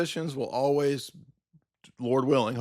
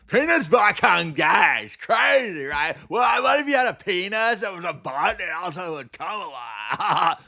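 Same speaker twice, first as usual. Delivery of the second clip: high, loud hammed-up dopey voice; mock bear comedy; 5 semitones higher, 3 dB louder than usual. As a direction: parody dumb humor loudly. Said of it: "that's my impression of bear comedy".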